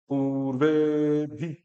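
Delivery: पूर्वे भी with long ऊ and ए, slow; long vowels. Shown as a demonstration. Teacher models पूर्वे भी with long vowels. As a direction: lengthen पूर्वे, keep भी short. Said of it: "ऊ को भी बड़ा करो".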